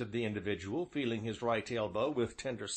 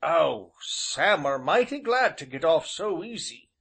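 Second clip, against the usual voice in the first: put-on high old countryman's voice; old rustic innkeeper; 5 semitones higher, 7 dB louder than usual. A narrator's reading. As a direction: voice the old villager, folksy and drawn. Ancient's dialect voice.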